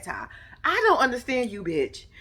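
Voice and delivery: usual speaking voice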